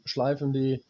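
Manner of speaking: normal talking voice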